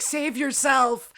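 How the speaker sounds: slow, high urgent mock warning. Rage rising at chewing noise, slow mock warning to herself. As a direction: drag it out like a doomed warning. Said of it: "this brief window when I'm like".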